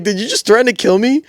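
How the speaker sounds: retold shocked question; incredulous retort. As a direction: replay your stunned question for the listener. Repeats his shocked reply when asked.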